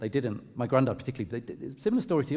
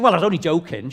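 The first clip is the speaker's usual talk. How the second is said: lightly voiced protesting mum, pitched up; a hint of mum brushing it off, louder; quoted mum; 4 semitones higher, 6 dB louder than usual. Mum defending her old put-down to her son, lightly voiced protest. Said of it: "And she said".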